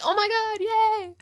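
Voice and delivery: high, drawn-out mock squeal of joy; imitating mom's delight. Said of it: "she's like".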